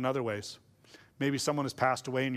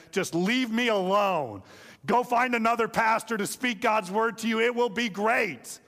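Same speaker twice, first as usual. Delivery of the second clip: raised, high-pitched comic insistence; mock exasperated plea; 6 semitones higher, 3 dB louder than usual. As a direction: call it out in playful exasperation. Preacher tells congregation not to conjure him.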